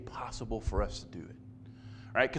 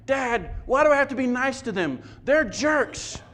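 put-on higher, quicker complaining child voice; whiny kid; 7 semitones higher, 4 dB louder than usual. Son whining to his father about the jerks. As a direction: play a kid protesting to his dad. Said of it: "imagine this conversation".

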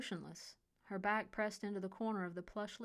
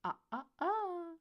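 high mocking tut; tut-tutting; 10 semitones higher, 4 dB louder than usual. A narrator's reading. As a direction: wag a finger with your voice. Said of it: "Declan interrupted him".

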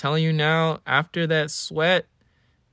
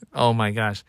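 ordinary delivery

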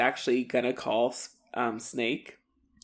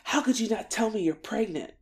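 higher-pitched retold reproach; mock indignant question; 7 semitones higher than usual. Snake barging in on Spike, mock indignant question. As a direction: act out hurt disbelief, pitched up.

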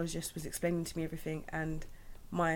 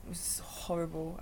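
real hushed whisper; 5 dB quieter than usual. Real, not acted. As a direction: whisper it, real distress at the memory. Recalling hated postnatal ward.

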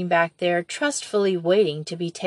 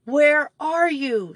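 shrieking; 8 semitones higher, 3 dB louder, slower than usual. A narrator's reading. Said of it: "shrieked Betsy".

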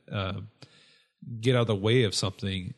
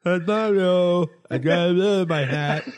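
goofy Higgins voice; 8 semitones higher, 5 dB louder than usual. Acted. Higgins shows Mario his hat letter in a goofy high voice. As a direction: boast to Mario like a happy fool.

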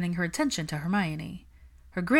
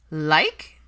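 loud one-word scoff; 8 dB louder than usual. A narrator's reading. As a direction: throw the word back in disbelief. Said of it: "Pansy scoffed".

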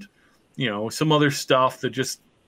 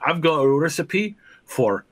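a slightly raised Irish lilt; accidental Irish accent; 4 semitones higher than usual. Acted. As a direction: slip lightly into an Irish accent. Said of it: "I'm going into Irish accent".

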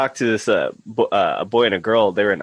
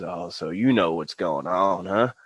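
put-on slow, lower old-man voice; 4 semitones lower, slower than usual. Old guy sizing up a fellow user, slow knowing old-man voice. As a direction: drawl it low and knowingly. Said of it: "this guy in the sixties".

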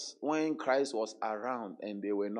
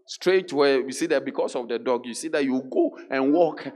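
mock stiff walking; 3 semitones higher, 12 dB louder than usual. Shown as a demonstration. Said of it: "You can walk like this".

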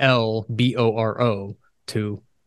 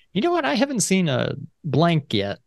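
high voice; 7 semitones higher than usual. Retold or imitated. Retold inner thought, high.

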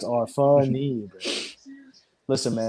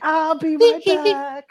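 high mock voice; 4 dB louder, much slower than usual.

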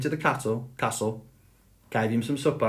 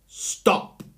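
shouted; 4 dB louder than usual. A narrator's reading. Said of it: "Then he shouted".

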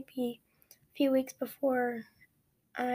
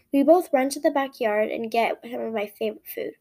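usual speaking voice

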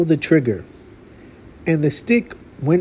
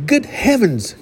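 high startled exclamation; startled cry; 8 semitones higher than usual. A narrator's reading. Hunter spotting the lion, startled cry. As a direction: cry out in alarm. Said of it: "cried the hunter".

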